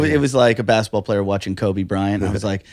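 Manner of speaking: ordinary talk, nothing marked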